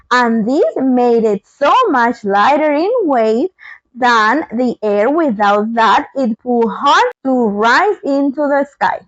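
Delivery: usual speaking voice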